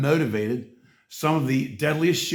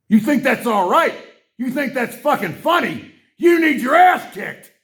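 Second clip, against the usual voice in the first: much higher, louder yelling; furious shout; 9 semitones higher, 6 dB louder than usual. Real, not acted. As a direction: bellow your rage at him. Furious rant at Musk.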